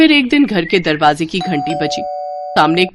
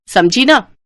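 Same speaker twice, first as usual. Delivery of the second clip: louder, higher threatening समझी ना; harsh warning; 4 semitones higher, 4 dB louder, slower than usual. Acted. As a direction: warn her bossily, समझी ना. Sister-in-law threatens. (धमकाते हुए समझी ना)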